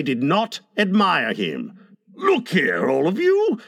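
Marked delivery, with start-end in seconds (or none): 2.2-3.6 entreating. A narrator's reading. Father appealing to his disapproving children, entreatingly. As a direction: pitch lifting high on the appeal. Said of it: "he said entreatingly".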